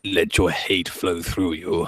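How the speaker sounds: low, slow villain intonation; dark villain quote. Acted. Quoting a villain to a co-host, darkly menacing. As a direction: drop deep and draw out the menace.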